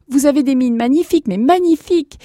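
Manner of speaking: high, loud overwhelmed praise; acted sobbing joy. Grandson admiring his grandparents, acted tearful joy. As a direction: gush as if tearfully overjoyed. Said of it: "sanglotait David Lustig".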